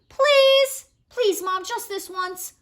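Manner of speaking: put-on pleading high, kid voice; begging child. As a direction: beg Mom like a little kid. Child begging parent for permission.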